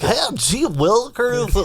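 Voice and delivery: high, slow goofy voice. Pretend. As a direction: play a corny wholesome guy, slow.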